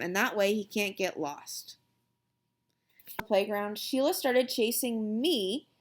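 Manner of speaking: ordinary delivery, nothing marked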